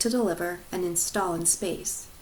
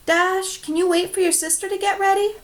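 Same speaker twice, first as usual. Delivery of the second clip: mom's voice; 11 semitones higher, 5 dB louder than usual. Acted. Mom voiced in story.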